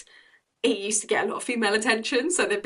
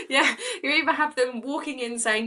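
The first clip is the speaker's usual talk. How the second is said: laughing; 7 semitones higher than usual. Real, not acted. Laughing while describing acts.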